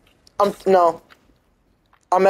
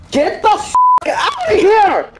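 voice crack; 13 semitones higher than usual. Real, not acted. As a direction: voice cracking high on a yell. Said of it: "Heard that voice crack?".

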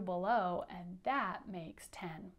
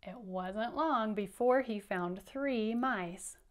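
usual speaking voice

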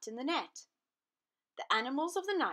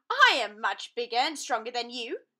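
boastful lion; 2 semitones higher, 10 dB louder than usual. Acted.

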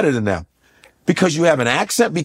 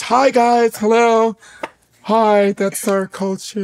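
polite greeting; 4 semitones higher, slower than usual. Retold or imitated. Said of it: "And I'm like this".

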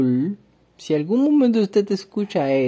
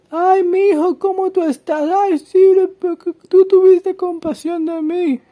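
much higher, louder syrupy gushing; mock fawning king; 14 semitones higher, 7 dB louder than usual. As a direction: overdo the sweet flattery mockingly. Mocks the fawning king Saul.

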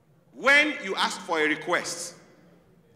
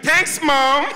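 higher, louder cheery "Thanks, Mom"; a hint of naive American kid; 8 semitones higher, 7 dB louder than usual. American kid naively thanking his mom, high and loud. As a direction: thank her, oblivious. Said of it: "America kid".

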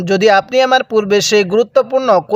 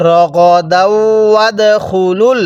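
drawn out tajweed chant; slow recitation; much slower than usual. Shown as a demonstration. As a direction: stretch রগদাউ ওয়াদখুলুল slowly. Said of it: "হ্যাঁ, কিভাবে শিখব?".

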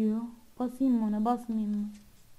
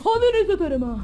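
startled yell, higher and louder; scared shout; 9 semitones higher, 7 dB louder than usual. Real, not acted. Gamer yelling as something jumps out. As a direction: blurt it loud in fright.

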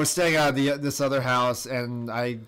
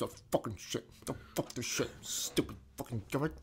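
much softer, lower swearing mutter; grumbling under breath; 3 semitones lower, 14 dB quieter than usual. Retold or imitated. Dad swearing himself to sleep, soft low mutter. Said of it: "He goes".

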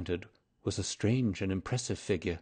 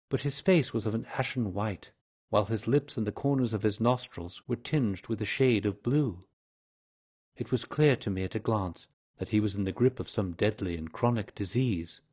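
plain reading voice

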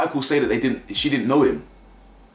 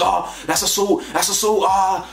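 high mocking copy of the cop, half-hearted; imitating the officer; 9 semitones higher than usual. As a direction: ham up the officer accusing her of assault. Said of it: "He says".

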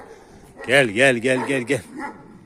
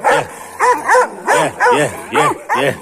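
calling the dogs; 12 semitones higher, 5 dB louder than usual. Real, not acted. Calling dogs during a fight.

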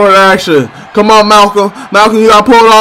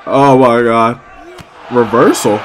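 ordinary talk

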